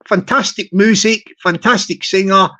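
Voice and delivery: ordinary talk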